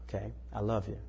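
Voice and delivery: soft, calm comfort after the spanking; a gentle, loving parent's voice; tender reassurance. Retold or imitated. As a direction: hold him and speak softly. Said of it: "In that tone of voice".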